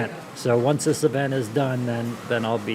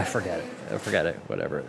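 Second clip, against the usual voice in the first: trailing off; 7 semitones lower, 7 dB quieter than usual. Real, not acted. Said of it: "sorry, I lost my train of thought".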